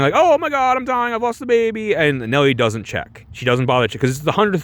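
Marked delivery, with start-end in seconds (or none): 0.1-1.9 high melodramatic wail; mock crying. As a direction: cry out theatrically, hamming it up. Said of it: "it's the same kind of crying".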